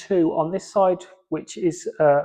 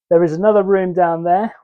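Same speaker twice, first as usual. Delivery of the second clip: usual speaking voice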